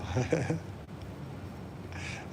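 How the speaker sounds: breathless high wheezing laugh; high silent giggle. Real, not acted. Vlogger cracking up at his own line, giggling.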